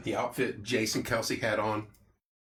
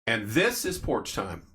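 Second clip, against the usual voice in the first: dramatic reveal; 3 semitones lower, slower than usual. Pretend. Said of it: "Pause for dramatic effect".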